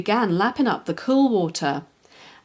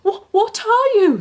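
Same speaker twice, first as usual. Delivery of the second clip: higher, louder, stammering fright; a scared pup blurts it out; quivering; 13 semitones higher, 6 dB louder than usual. A narrator's reading. Puppy meets a strange creature, quivering. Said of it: "said a quivering Scamper".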